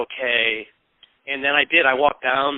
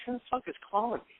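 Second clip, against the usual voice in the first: hushed, higher grumble to oneself; sleepy grumble, retold; 8 semitones higher, 12 dB quieter than usual. Man woken by phone clicks, hushed retold grumble. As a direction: retell a bothered thought under the breath.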